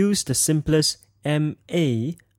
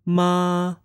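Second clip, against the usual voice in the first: MA held high and level; 4 semitones higher than usual. Shown as a demonstration. Teacher demonstrates Chinese sounds, high level tone. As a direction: say MA high, steady and drawn out. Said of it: "And the Chinese sounds".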